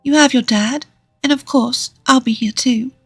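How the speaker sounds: put-on high, gentle woman's voice for children. A narrator's reading. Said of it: "Lisa spoke to Molly and Clara in a gentle voice".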